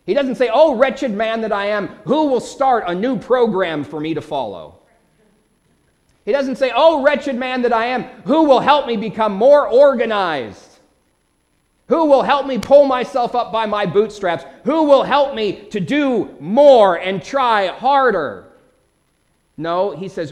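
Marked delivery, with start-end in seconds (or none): 0.5-4.7 raised, declaimed cry for help; hammed-up plea of a wretched man; mock desperate plea. Mock version of Paul's plea. 6.7-18.4 high, loud, mounting appeal; mock desperate plea. Mock pleas Paul did not make.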